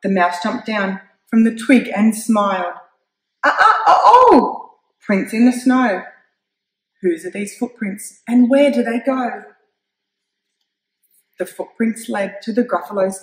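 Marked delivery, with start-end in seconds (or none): 3.4-9.4 loud, higher mock gasping exclamations; mock surprise. The mouse teases the child with loud, high mock surprise. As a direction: ham up the startled discovery.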